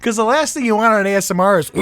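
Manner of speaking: usual speaking voice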